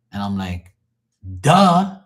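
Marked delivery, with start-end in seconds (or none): 1.4-2.0 scornful duh. Retold or imitated. Mocking rival's reading.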